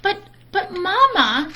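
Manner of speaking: high, pleading cub voice; protesting cubs. Acted. Cubs plead with Mama, high and whiny.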